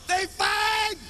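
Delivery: high yelled announcement; announcement shout. Retold or imitated. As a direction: yell it out to the whole block. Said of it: "Made an announcement".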